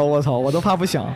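ordinary talk

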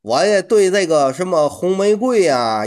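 ordinary talk

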